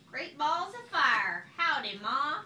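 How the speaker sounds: loud slow cowboy-ish goose; loud slow drawling greeting; baby goose. A narrator's reading. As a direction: holler it like a little cowpoke.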